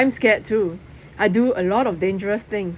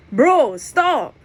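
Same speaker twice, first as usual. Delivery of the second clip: loud urgent plea; urgent call; 7 semitones higher, 7 dB louder than usual. Acted. Press urging Jack to stop throwing poppers, loud. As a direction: stop him now, loudly.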